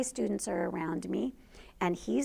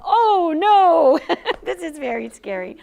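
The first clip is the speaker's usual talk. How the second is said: laughing mock alarm; 8 semitones higher, 12 dB louder than usual. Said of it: "Mister Polero was just all".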